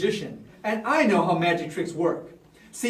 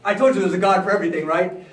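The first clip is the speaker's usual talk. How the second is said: ordinary delivery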